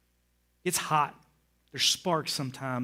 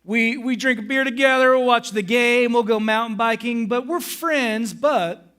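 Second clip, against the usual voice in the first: loud, high, driving sermon voice; forceful preaching; 7 semitones higher, 9 dB louder than usual. Acted. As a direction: preach it hard to the room.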